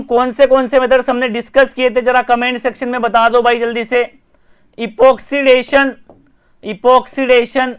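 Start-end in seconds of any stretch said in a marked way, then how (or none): 4.8-7.7 slow drawn-out term. Shown as a demonstration. Teacher spells out the term.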